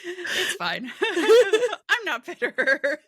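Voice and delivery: laughing through the words, way up high; giggling while pretending not to be hurt. Real, not acted. Cohost teased about her joke, laughing through it.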